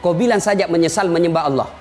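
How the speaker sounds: low, casual coaxing devil; tempting devil. Acted. Devil bargains with a thirsty dying man, coaxing. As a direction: make the condition sound easy.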